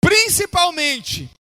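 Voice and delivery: fervent pulpit exhortation; impassioned preaching. Real, not acted. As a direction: preach it to the congregation with stretched key words. Preaches at full force.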